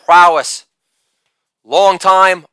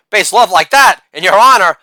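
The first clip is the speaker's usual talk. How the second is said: normal talking voice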